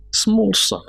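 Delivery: sudden high, keening cry of grief; wailing cry. Acted. Preacher laments the orphaned son, wailing. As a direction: cry the words out in mourning.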